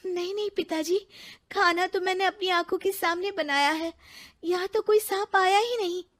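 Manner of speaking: high, anxious protest; alarmed denial. Acted. Ritu protesting to the sage about the food, alarmed and high. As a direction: insist in alarm she did nothing.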